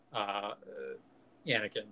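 normal talking voice

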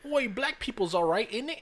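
high put-on cockney voice, a jokey British bloke; mock British accent. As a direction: play a hammed-up Londoner, pitched high. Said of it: "mock British man".

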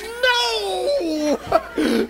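very high, loud, laughing cry of NO; high, drawn-out wail of refusal; mock shrieked no. Woman worked up by the game, mock shrieked refusal. She cries out, acted.

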